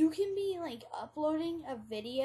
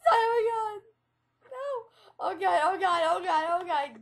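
terrified gibbering; 5 semitones higher, 4 dB louder than usual. Pretend. Fleeing crazy bear in game.

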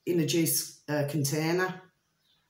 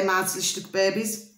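usual speaking voice

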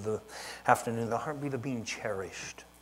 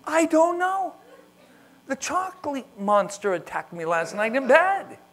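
put-on loud, high little kid making up a story; kid excuse voice; 10 dB louder than usual. Child telling parent the chocolate monster did it, loud high kid voice. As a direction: squeal out a silly excuse like a small child.

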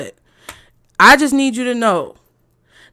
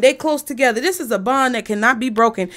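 ordinary talk, nothing marked

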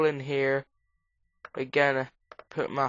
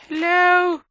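a loud, high sing-song hello; high calling out; 8 dB louder than usual. Acted. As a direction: call out to someone in the dark. Calling out to a creature.

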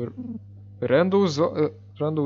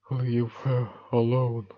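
timid, softer plea that fades to nearly nothing; pathetic voice; 5 dB quieter than usual. Acted. Hero pleading with the bully to leave her alone, pathetic. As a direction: beg weakly, stumbling on please. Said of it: "I have to get my pathetic voice on".

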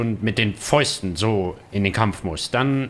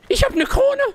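much higher squeal of glee; squealing excitement; 5 dB louder than usual. Real, not acted. Repeats it a third time. (quietschend vor Freude)

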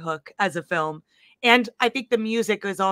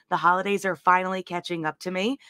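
usual speaking voice

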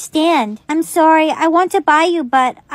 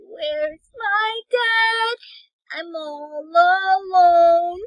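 sad; 4 dB quieter, much slower than usual. Acted. Abandoned child alone.

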